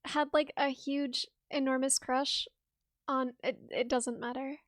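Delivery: soft, trailing embarrassed confession; embarrassed mumble. Real, not acted. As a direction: mumble it, shy and flustered.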